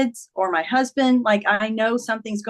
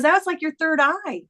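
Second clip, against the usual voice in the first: higher-pitched quote of an eager daughter; lightly voiced daughter, pitched up; daughter's insight; 6 semitones higher than usual. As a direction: relay her revelation in a raised pitch. Quoting daughter's excited insight.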